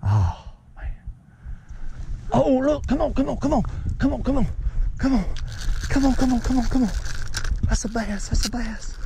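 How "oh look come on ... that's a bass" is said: high, eager urging of come on; excited urging. Real, not acted. Dad urging a bass onto his son's line, excited. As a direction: egg the fish in, breathless with hope.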